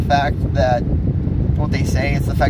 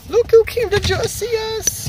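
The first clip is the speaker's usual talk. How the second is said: talking to a dog. Real, not acted. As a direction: coo at the dog in a high voice. Greeting the dog.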